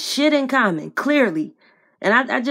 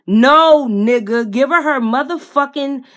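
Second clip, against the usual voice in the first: a loud, sharp "No"; loud outburst; 3 semitones higher, 6 dB louder than usual. Real, not acted. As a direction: shout the refusal at him. Outburst at the boyfriend.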